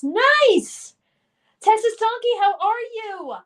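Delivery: high, loud, delighted cheer; excited greeting. Real, not acted. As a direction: greet a viewer with a big excited call. Excited greeting to a chat viewer.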